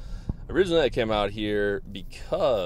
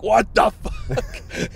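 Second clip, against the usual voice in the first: mock angry dad; 13 semitones higher, 5 dB louder, much slower than usual. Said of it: "He's like".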